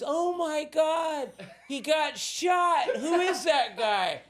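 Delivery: high, slow, loud alarmed cry; mock panic. Bystander seeing him smoke, mock panicked cry. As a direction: play the horrified bystander.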